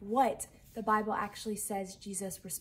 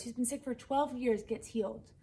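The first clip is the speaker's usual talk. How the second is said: ordinary delivery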